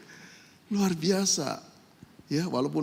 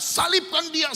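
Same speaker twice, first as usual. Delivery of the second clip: crowd shouting; 11 semitones higher than usual. Retold or imitated. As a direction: yell it like an angry mob. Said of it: "orang teriak-teriak".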